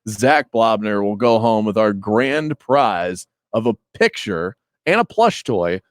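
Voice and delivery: game show host sign-off, slow; booming prize announcer. Acted.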